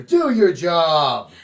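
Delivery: slow, loud demand; calling out. Real, not acted. Telling Governor Baker to do his job, slow and loud.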